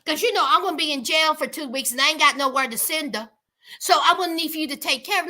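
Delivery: fast, breezy demand; offhand, hurried ask of her mother; entitled demand. Retold or imitated. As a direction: rattle off the request like it's nothing. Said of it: "she looks at her mom and says".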